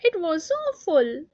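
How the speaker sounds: put-on a child's tearful wail. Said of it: "she wailed again".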